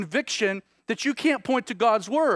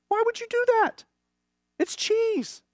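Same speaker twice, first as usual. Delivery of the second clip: high-pitched mock exasperation at the store; exasperated. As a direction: squeak out the disbelief, hammed-up. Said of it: "I getting really frustrated".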